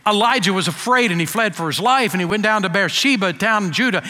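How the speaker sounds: ordinary delivery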